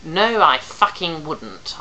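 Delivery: slow, loud mimicking yell; a sarcastic shouted mimic; mocking scream. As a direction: throw his own rage back at him. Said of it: "also screaming with ear-splitting vociferousness".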